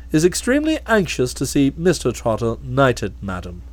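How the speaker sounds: low, calm, deferential butler voice; a low, imperturbable manservant; Jeeves the valet. Acted.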